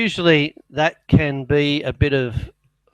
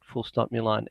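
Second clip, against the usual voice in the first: softer aside dictating punctuation; 3 semitones lower, 9 dB quieter than usual. Real, not acted. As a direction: drop the voice for the punctuation commands.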